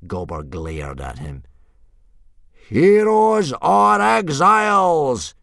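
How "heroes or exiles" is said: very high, drawn-out bellow; huge slow battle cry; yell. A narrator's reading. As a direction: yell it slowly to rally a crowd. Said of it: "yelled Gobber the Belch".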